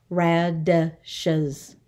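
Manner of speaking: "Radishes" in three separate beats, ra-di-shes; syllable by syllable. Shown as a demonstration. Teacher counting for the class, "Radishes" syllable by syllable. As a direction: sound out "Radishes" with gaps between syllables. Syllable lesson, word broken up.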